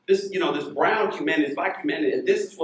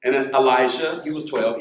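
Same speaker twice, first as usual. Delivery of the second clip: ordinary talk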